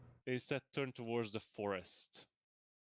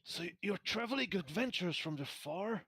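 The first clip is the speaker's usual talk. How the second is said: put-on raised, pitched-up young soldier asking eagerly; npc soldier voice; 7 semitones higher than usual. GM voicing a soldier, asking the party, high and eager.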